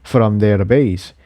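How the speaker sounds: plain reading voice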